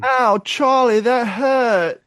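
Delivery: put-on high, whiny British kid voice; high British accent, childlike hurt; British child accent. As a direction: imitate the British boy crying out. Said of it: "still doing the British accent".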